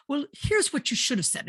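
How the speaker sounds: high, imitated Irish husband; mock Irish husband. Husband correcting his wife's words, mock Irish imitation. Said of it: "this is my imitation of my husband".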